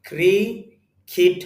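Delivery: ক্রিকিট slowly split, "kree ... kit"; slow teaching ক্রিকিট with i in both syllables; English pronunciation. Shown as a demonstration. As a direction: break ক্রিকিট into two syllables, ending "kit". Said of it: "ক্রিকিট হবে".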